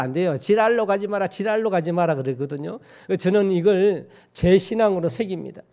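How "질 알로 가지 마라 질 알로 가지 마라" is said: "질 알로" run together quickly so it sounds like 지랄로; said fast. Shown as a demonstration. Preacher showing how the dialect warning slurs when said fast. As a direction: rattle "질 알로 가지 마라" off fast, slurring "질 알로" into one word. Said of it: "빨리 하면 어떻게 돼요".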